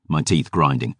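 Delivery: ordinary delivery, nothing marked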